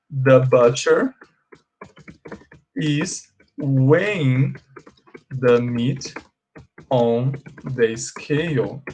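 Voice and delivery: slow, drawn-out words for the class; slow model sentence. Shown as a demonstration. Teacher modelling the correct sentence, slow and drawn out. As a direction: stretch each word for students to copy. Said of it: "poderia falar sem problema".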